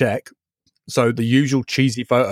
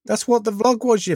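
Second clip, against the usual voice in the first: pitched way up, insisting; high, insistent; 11 semitones higher than usual. Real, not acted. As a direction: protest in a raised voice. Arguing that his vlog is on topic.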